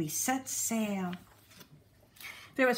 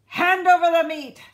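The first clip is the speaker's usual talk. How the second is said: much louder, higher pirate demand; demanding loudly; 7 semitones higher, 11 dB louder than usual. A narrator's reading. Braidbeard demanding meat at the table, loud bark. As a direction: bark it with your mouth full. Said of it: "gulped his food and said".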